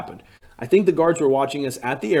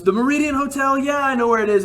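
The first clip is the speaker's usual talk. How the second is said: the driver's voice, pitched much higher; eager taxi driver; 11 semitones higher than usual. Retold or imitated. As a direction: play the overeager driver who swears he knows the way. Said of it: "He speaks kind of broken English".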